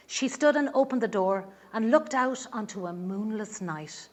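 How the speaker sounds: ordinary delivery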